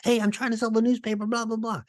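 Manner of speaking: very high, fast, chirpy salesman parody; mock upbeat salesman. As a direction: parody the over-eager phone seller, squeaky and rushed. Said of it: "encourage all this employees to be so upbeat".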